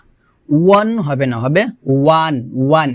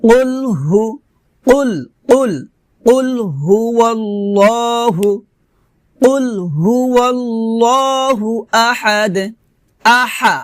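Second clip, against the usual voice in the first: higher, slow held recitation; Quran recitation; 4 semitones higher than usual. Shown as a demonstration. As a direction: chant the verse with long level vowels. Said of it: "আমার সাথে বলেন".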